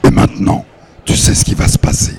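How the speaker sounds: hushed, voiceless and eerie; sepulchral whisper. Shown as a demonstration. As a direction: breathe it out like a ghost. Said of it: "Parlez comme ça".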